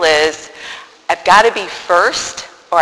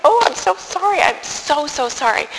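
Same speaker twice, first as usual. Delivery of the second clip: higher, softer mock tearful apology; retold crying; 8 semitones higher, 4 dB quieter than usual. Penitent telling the priest she is sorry, mock tearful. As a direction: plead through imitated tears. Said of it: "and I started to cry".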